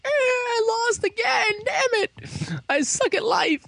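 hammed-up sore loser's tantrum; mock wailing. Loser villain wails in retelling.

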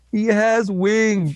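high, drawn-out mock protest; hammed-up whine, stretched out slowly; whiny protest. Retelling the hero's excuse to a friend, whiny protest.

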